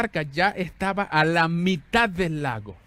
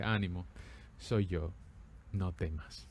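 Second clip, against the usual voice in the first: much softer and lower, tender; gentle reassurance; 10 semitones lower, 11 dB quieter, slower than usual. Acted. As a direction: speak it like a familiar voice at a hospital bed at night. Jesus comforts the sick believer.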